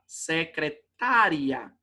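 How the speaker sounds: "Secretária" slowly, long stressed TÁ. Shown as a demonstration. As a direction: draw out "Secretária", leaning on TÁ before a joined ria. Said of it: "o correto seria".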